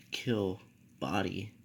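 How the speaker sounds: usual speaking voice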